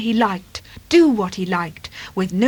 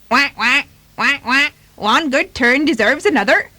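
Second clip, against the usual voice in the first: higher, louder quacking duck voice; put-on loud duck; Dorothy Duck; 5 semitones higher, 4 dB louder than usual. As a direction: answer briskly like a quacking duck. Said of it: "replied Dorothy Duck".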